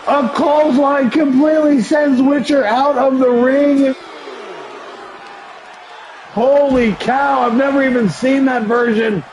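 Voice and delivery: high, energetic ringside wrestling commentary; excited play-by-play. Acted. Streamer calling his wrestling game match for viewers, excited play-by-play. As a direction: call every move like a live announcer.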